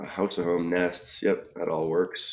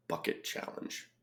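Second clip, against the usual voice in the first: whisper; 12 dB quieter than usual. Real, not acted. Repeats clue under breath.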